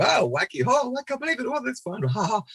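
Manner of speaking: put-on high-pitched, zany kids' telly chatter; mock high-pitched presenter glee. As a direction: act delighted in a squeaky, over-the-top way. Acts upbeat kids' TV presenting.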